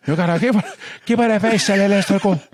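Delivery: put-on high, garbled Spanish-accented announcer; put-on Latin presenter accent. Repeats put-on Telemundo gibberish.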